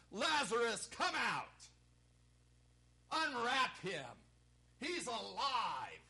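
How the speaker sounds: high, projected, drawn-out cry; triumphant high call. Acted. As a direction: call into the tomb, stretching the words. Re-enacts Jesus calling Lazarus.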